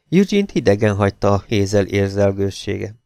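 ordinary delivery

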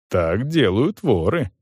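ordinary delivery, nothing marked

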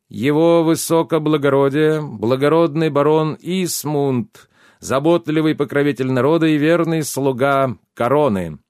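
loud, high herald's proclamation; торжественное объявление. A narrator's reading. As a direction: announce a noble guest like a court herald, drawing out the titles. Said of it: "Герольд торжественно объявил". (торжественно и громко, как герольд)

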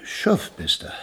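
Schoff with an o sound instead of Schiff; Schoff, matter-of-fact correction; alien pronunciation. Acted. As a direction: correct him: Schoff, rounding the vowel. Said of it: "Raumschoff heißt das".

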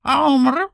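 high questioning kitten chirp; a kitten's rising meow-chirp. A narrator's reading. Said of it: "с вопросительной интонацией".

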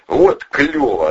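loud, high, drawn-out cheer; gleeful delight. A narrator's reading. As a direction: whoop it out, thrilled by the ride. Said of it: "радовался Вовчик". (радостный восторженный возглас)

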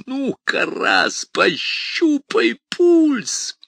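high, loud, slow demon mockery; mocking demon. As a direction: rise into a gloating howl. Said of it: "усмехнулся бес".

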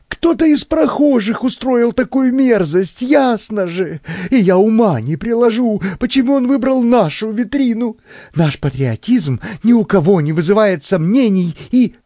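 high, gabbling panic; nervous shock. A narrator's reading. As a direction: protest innocence in a high, rattled voice. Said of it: "стал проявлять симптомы глубокого нервного потрясения".

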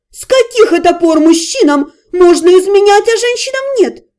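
outraged woman demanding fairness; aunt yelling at the jilted groom; angry aunt. A narrator's reading. Aunt furious at double standard.

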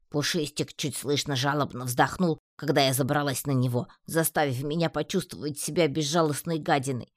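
plain reading voice

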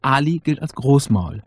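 ordinary talk

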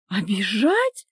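slow, rising incredulous question; indignant echo. A narrator's reading. Wife echoes husband's word, indignant rising question. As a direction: throw the word back, rising in outrage. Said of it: "возмутилась та".

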